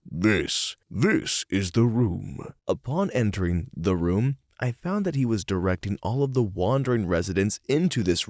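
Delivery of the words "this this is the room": movie trailer narrator voice. Retold or imitated. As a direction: intone it like a film trailer. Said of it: "in the deepest movie trailer narrator voice".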